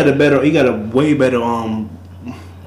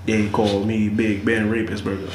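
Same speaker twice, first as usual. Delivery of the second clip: ordinary delivery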